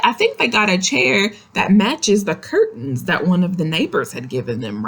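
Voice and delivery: ordinary delivery, nothing marked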